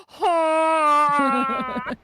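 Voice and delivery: loud high triumphant cheer; moose cheer. Acted. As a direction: bellow hurrah like a proud moose. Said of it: "Make the boy moose go".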